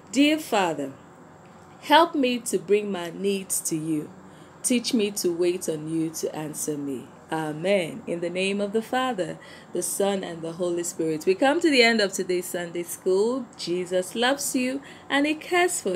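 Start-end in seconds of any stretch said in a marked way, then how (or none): 0.1-10.2 gentle prayer. Acted. Leads children in prayer.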